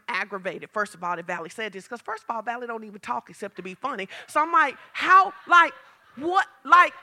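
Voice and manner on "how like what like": loud, high re-enacted outrage in halting bursts; indignant disbelief. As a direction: build to a stretched, affronted question. Aggravated reaction acted out.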